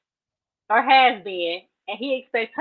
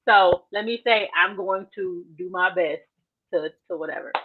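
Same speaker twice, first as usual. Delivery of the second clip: usual speaking voice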